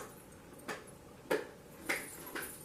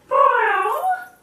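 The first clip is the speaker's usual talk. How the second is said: much higher, drawn-out cat refusal; snooty cat; 9 semitones higher, 4 dB louder, much slower than usual. Acted. Cat refuses the hen, high snooty voice. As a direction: sniff it off like a haughty cat. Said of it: "said the cat".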